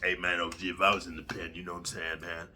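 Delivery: low, soft, lightly voiced tough guy; a hint of a macho commenter; mock commenter. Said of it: "you guys in the comments be like".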